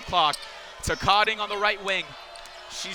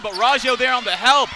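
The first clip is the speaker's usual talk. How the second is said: raised, louder live call; excited play-by-play; 3 semitones higher, 8 dB louder than usual. Real, not acted. Commentator calls a help defense play, excitedly.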